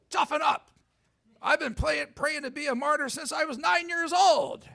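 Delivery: high, forceful little nun telling him to toughen up. Retold or imitated. Tiny Albanian nun scolding a nervous priest, high and forceful. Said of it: "four-foot-eight Albanian nun says".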